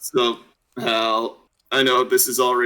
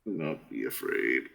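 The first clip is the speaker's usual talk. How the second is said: very low, soft mock-ominous intonation; deep ominous voice; 13 semitones lower, 11 dB quieter than usual. Player echoing a favorite line, deep ominous voice. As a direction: drop far down for a solemn proclamation. Echoes angel-like line in character.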